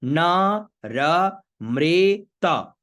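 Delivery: न र मृत very slow, each syllable held apart; syllable-by-syllable demo. Shown as a demonstration. Teacher introducing न र मृत, very slow separated syllables. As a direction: spell out न, र, "mre", त by sound. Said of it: "तो नारा मृत".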